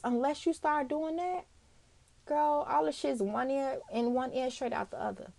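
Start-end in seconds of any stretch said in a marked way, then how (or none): none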